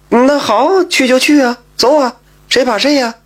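high-pitched, devil-may-care retort; defiant acceptance. A narrator's reading. Pedlar agreeing to face the magistrate, defiant. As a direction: take up the dare, pitched well up.

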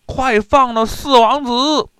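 high, loud demand; soldiers shouting. A narrator's reading. Soldiers demanding their prince's release, high loud shout. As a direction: yell across the river. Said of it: "他们大喊".

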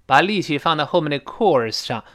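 ordinary delivery

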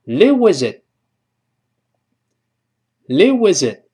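"live with it" linked, t not released; unreleased final t. Shown as a demonstration. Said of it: "读快之后也会失去爆破".

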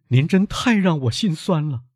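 high, distressed outburst; heartbroken son. Acted. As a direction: exclaim in anguish to your father. Son overcome by father's sacrifice.

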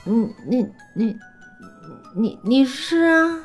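stammering, shaky and slow; trembling voice. A narrator's reading. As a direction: falter over each word in dread. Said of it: "声音都在发抖".

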